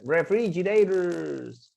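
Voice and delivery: "Refrigerators" slowly stretched to the end; pronunciation model. Shown as a demonstration. Teacher asking students to practice the word, slow and stretched. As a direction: draw out "Refrigerators" for repeat-after-me practice. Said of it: "Practíquenla".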